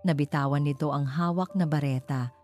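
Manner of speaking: usual speaking voice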